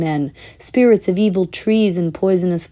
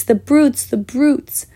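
1 tearful outrage; 6 semitones higher, slower than usual. A narrator's reading. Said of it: "tears streaming down her cheeks".

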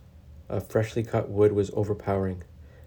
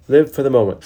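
higher and louder, a rallying motto; emphatic; 4 semitones higher, 7 dB louder, faster than usual. A narrator's reading. Jed telling friends to live for the moment, bright proclamation.

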